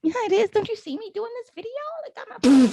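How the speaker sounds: high, fast whiny protest; mock protest to mom. Daughter whining to her mom about her videos, high and fast. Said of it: "I was like".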